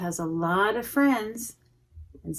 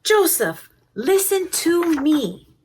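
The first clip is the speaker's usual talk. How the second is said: firm mother; 7 semitones higher, 5 dB louder than usual. Acted. Mother calling Joseph firmly as she runs after him. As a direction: call him to stop and listen.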